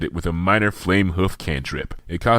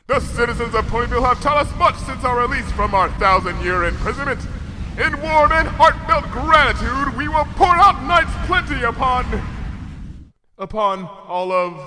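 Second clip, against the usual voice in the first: grand royal Luna rising to a shout; 14 semitones higher than usual. A narrator's reading. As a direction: proclaim gratitude then thunder it. Luna's grand gratitude speech.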